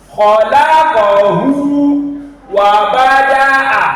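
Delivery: loud, slow chanted "Khalaqahu" with long "hu"; stretched ending. Shown as a demonstration. Teacher reciting the example, "Khalaqahu" stretched. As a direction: recite it slowly, holding the "hu". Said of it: "This is our emphasis".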